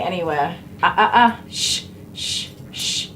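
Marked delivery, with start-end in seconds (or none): 1.5-3.1 repeated voiceless shushing; soothing shush. Real, not acted. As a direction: hush a restless dog. Hushing the wriggling dog.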